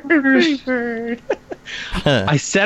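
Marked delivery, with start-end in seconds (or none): 0.1-1.2 high, slow squawky bird; drawn-out parakeet squawk; parrot voice. Pretend. Parrot imitation echoed. 1.3-2.0 high giggle. Real, not acted. Laugh after parrot bit.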